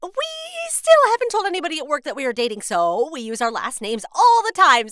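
loud, rushed explanation; hurried excuse. Acted. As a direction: gabble out a cover story. Hasty cover story for father.

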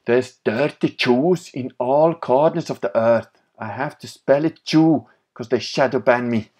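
put-on German accent. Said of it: "with German accent".

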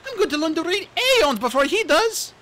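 put-on high, bright young dragonette voice; chirpy excited young dragon; eager little RainWing voice; Kinkajou's voice. Kinkajou boasting.

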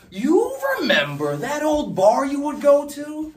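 high-pitched, loud, breathless-paced run-on rant; frantic shouting. Acted. Speaker frantically retraces the lost note for Jack, shouting high.

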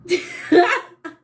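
high, loud laugh; burst of laughter. Real, not acted. Eric asks for eyelashes.

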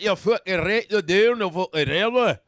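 loud, garbled mock Scottish; Scottish accent. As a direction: insist in incomprehensible Scottish brogue. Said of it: "the worst is the Scottish".

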